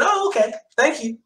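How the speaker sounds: a bright, high, breezy okay as if nothing is wrong; cheerful okay. Retold or imitated. Answering the nurse's grim news with high cheerful calm. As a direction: chirp it back lightly, unbothered by the bad news. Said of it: "I said it so cheerfully".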